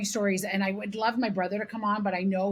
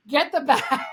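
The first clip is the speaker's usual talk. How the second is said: slow, raised retold shout; urgent yell; 5 semitones higher, 6 dB louder than usual. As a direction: draw out dad's loud order. Dad calling urgently for bat.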